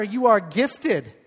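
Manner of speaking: preacher leads the church telling neighbors, loud and slow; loud declaration. Shown as a demonstration. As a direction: call it out to the person beside you. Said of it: "Tell him or her".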